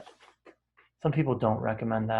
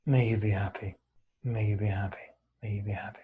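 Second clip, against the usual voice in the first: low, gentle recitation with soft falling endings; guided meditation; 3 semitones lower, 3 dB quieter, faster than usual. Real, not acted. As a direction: repeat the wish calmly, letting it settle into quiet. Recites the loving-kindness phrase.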